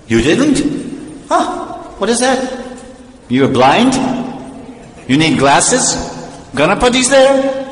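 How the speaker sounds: high, rising questions of disbelief; incredulous. Acted. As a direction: squeak up at each question, astonished. Devotee disbelieves the other's complaint.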